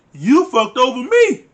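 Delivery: loud, high, drawn-out accusation; indignant retort. Retold or imitated. Acting out Paige refusing to apologize, indignant. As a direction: throw the blame back, loud and slow. Acted retort in the couple's quarrel.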